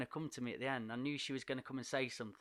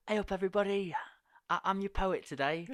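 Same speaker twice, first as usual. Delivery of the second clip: bright, higher, louder hello with a laugh in it; cheery poet greeting; 7 semitones higher, 5 dB louder than usual. Retold or imitated. Poet greeting the lads on his first day, laughing. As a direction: greet a tough room with forced cheer.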